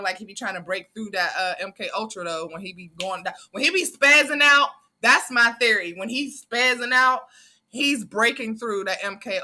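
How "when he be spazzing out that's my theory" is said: excited. Real, not acted. Excited theory about [name] spazzing.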